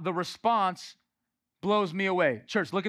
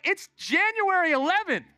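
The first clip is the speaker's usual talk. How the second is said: high, loud incredulous protest; exasperated disbelief; 10 semitones higher, 3 dB louder than usual. Retold or imitated. Preacher to someone waiting for a birthday baptism, exasperated disbelief. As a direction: burst out, baffled at the long delay. Acted exasperated reply to postponer.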